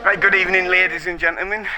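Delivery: announcer greeting. Acted. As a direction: open the show like a host. Stream intro greeting.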